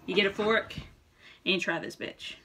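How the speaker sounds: normal talking voice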